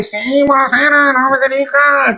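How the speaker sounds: high female caller voice; over the phone. Acted. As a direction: play a woman calling in. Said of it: "abbiamo Federica in collegamento".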